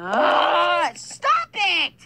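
fed-up yell; annoyed shout. Acted. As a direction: snap at the pest. Fed up with honking.

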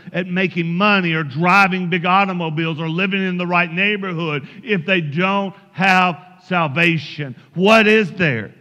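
ordinary talk, nothing marked

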